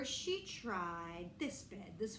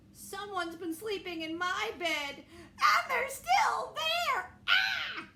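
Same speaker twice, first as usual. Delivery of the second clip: laughing, then a very high, loud slow cry; a cub shrieking in mock alarm; Baby Bear alarmed; 10 semitones higher, 8 dB louder, slower than usual. Baby Bear finds Goldilocks.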